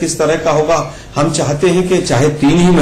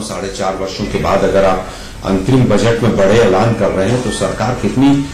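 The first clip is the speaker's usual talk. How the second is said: echo; 6 semitones lower than usual. Real, not acted. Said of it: "बहुत इको आ रही है मेरे उसमें ना".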